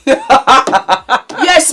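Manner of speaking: ordinary talk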